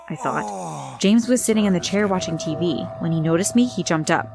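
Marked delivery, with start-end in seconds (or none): none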